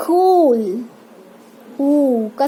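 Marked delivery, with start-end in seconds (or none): 0.1-0.9 कूल with a stretched oo; long u. Shown as a demonstration. Teacher models the big u, कूल with lengthened oo. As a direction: draw out the vowel in कूल slowly. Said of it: "ये longer है".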